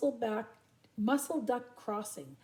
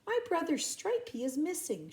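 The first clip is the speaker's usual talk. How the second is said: a hint of small chick voice; lightly raised little chick; 7 semitones higher than usual. Chick voice in story.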